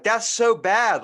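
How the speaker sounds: high, loud, drawn-out protest; loud, slow mock outrage; appalled protest. Student telling off the friends who taught dirty words, appalled. Acted reaction to being pranked.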